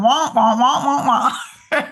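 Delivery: muffled trombone wah-wah, slow and laughing; laughing wah wah wah sounds, drawn out; Charlie Brown's mom. Retold or imitated. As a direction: mimic the muffled adult voice from the cartoon. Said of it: "like Charlie Brown's mom".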